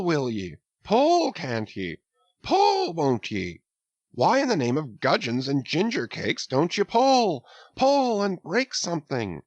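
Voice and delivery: jolly urging. A narrator's reading. Stubb cheering his crew to pull harder, jolly. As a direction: egg the crew on merrily.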